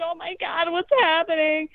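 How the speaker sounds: high-pitched imitation of distressed little gargoyles; mock crying kids. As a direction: squeal in pretend childish distress. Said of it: "everyone's crying".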